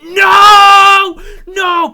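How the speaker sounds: very loud, high, drawn-out cry; retold anguished scream. Player reliving Sadie getting shanked, screaming in protest. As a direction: scream it out, re-enacting horror. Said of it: "and, y-, and I'm like".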